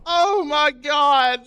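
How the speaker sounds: high, loud, drawn-out cry; shocked exclamation. Real, not acted. Streamer stunned by a game moment, loud shocked cry. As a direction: gasp it out stunned.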